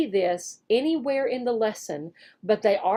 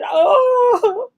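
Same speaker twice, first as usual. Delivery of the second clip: mock pity; 11 semitones higher, 6 dB louder than usual. Mock sympathy for sad girl.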